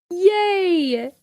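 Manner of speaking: excited cheer. Real, not acted. Sister cheering her brother's first tattoo, high and excited. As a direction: cheer like it's great news.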